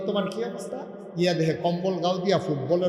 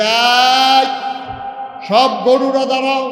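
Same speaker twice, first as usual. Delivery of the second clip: loud, higher commanding call; summoning call; 7 semitones higher, 10 dB louder, slower than usual. Acted. Summoning the cows to stand, loud and commanding.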